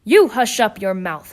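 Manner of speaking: loud angry rebuke; howled rebuke. Acted. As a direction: shut him up angrily. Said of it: "howled the mighty King Turtle".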